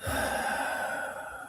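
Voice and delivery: soft, drawn-out breathing sigh; slow audible out-breath; long exhale. Shown as a demonstration. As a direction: let a long, quiet breath go. Models effortless breathing in meditation.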